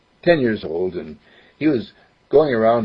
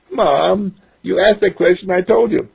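put-on higher boy's voice, eager; young boy voice; 9 semitones higher, 3 dB louder than usual. Boy explains he only got in dad's way, higher boyish voice. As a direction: voice a ten-year-old who wants to help. Mark to mom.

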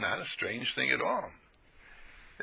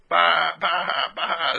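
mock sheep bleating; 4 semitones higher, 4 dB louder, much slower than usual. Preacher imitates sheep bleating.

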